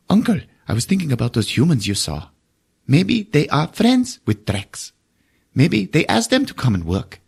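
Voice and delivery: put-on a light child voice asking his uncle; kid voice. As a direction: play the little alien boy, earnest. Voicing the boy Zombies.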